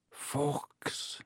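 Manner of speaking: faint, husky half-whisper; thick voice. A narrator's reading. Wounded boy to his bird, thick and faint. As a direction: barely get it out, thickly. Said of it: "said Harry thickly".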